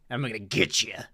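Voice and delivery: low, soft menacing line; mildly creepy growl; creepy threat. Pretend. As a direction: tease like a lurking ghost. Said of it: "weird-ass noises".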